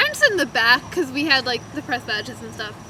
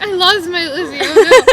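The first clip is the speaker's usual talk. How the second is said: mock panic; 4 semitones higher, 11 dB louder than usual. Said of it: "I was like".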